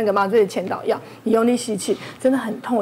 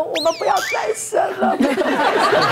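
retold crying shout; 7 semitones higher than usual. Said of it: "在那边一直喊说".

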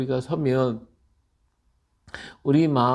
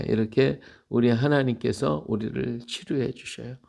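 ordinary delivery

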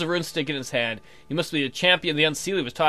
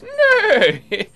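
a much higher, louder hoarse cry of Nay!; hoarse creature cry; 10 dB louder than usual. A narrator's reading. Fey stranger halting the knight on the path, high hoarse cry. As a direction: call out a hoarse warning from the dark woods. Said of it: "Suddenly you hear a hoarse voice".

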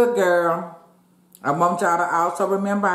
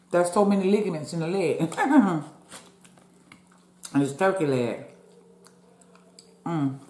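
normal talking voice

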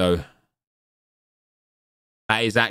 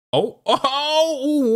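much higher, slow, laughing oh oh; excited laugh; much slower than usual. Real, not acted. Watching a near chip goal, laughing excitedly. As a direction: laugh out the ohs in disbelief.

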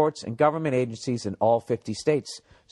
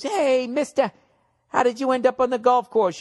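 put-on kid voice; 9 semitones higher than usual. Young kid asks old man.

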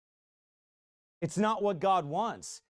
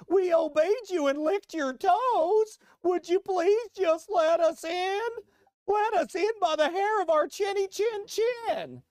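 high, slow, pleading mock whine; mock a groveling preacher begging the governor; sniveling beggar voice, much higher; whiny begging preacher; 13 semitones higher, 5 dB louder, slower than usual. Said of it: "That's really kind of what he sounds like".

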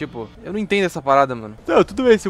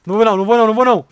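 loud, raised, urgent protest; frantic refusal; 4 semitones higher, 9 dB louder, much faster than usual. Real, not acted. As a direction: blurt it out in a panic, over and over. Recusa aflita em pleno perigo. (recusa aflita em voz alta)

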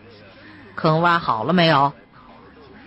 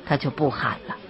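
usual speaking voice

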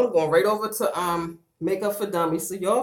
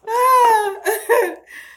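high wailing laugh; crying laughing; 6 dB louder than usual. Real, not acted. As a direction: laugh until tears come. Laughing to tears.

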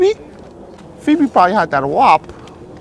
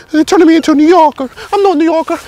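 high, loud hammed-up protest; mock panic; 6 semitones higher, 6 dB louder than usual. Luigi insisting he's no New Yorker, mock panic.

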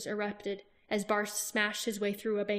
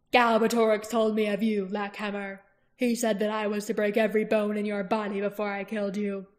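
mocking warlord; 4 dB louder than usual. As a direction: sneer at the challenger. Barst threatens Roran.

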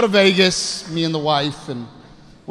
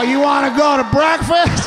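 high, loud tipsy invitation; mock boozy calling out; imitated drunk husband; 8 semitones higher, 4 dB louder than usual. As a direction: holler it with oblivious drunken cheer. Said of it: "Crown Royal that I was drinking".

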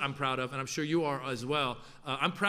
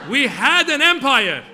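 put-on higher, much louder, slow proclamation in a Persian accent; booming Persian accent; 9 semitones higher, 14 dB louder, slower than usual. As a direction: bellow it like a grand declaration, Persian accent. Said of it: "And my dad would say".

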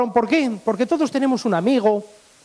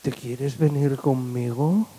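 low, slow seductive invitation; flirting blonde; 8 semitones lower, 4 dB quieter, slower than usual. Acted. Blonde invites Beni to leave with her, seductive. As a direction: purr it low and drawn out, lifting at the end. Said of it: "se le acercó una rubia".

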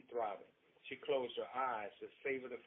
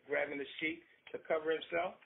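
ordinary delivery

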